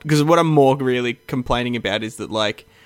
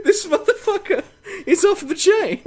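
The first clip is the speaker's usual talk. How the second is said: laughing. Real, not acted. Laughing at the video.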